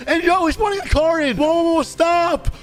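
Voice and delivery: high-pitched panicked yelling to stop; alarmed outburst. Real, not acted. Suspect suddenly escapes by car.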